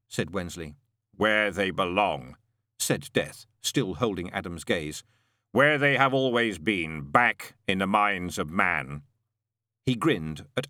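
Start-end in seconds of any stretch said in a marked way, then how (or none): 1.1-2.4 slow, echoing, otherworldly voice; deep calm of an immortal; voice of Death. A narrator's reading. Death speaks slowly. 5.5-9.0 slow, echoing, otherworldly voice; voice of Death. A narrator's reading. Death speaks slowly.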